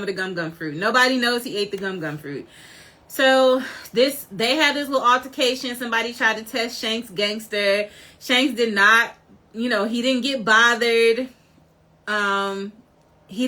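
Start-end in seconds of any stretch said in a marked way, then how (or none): none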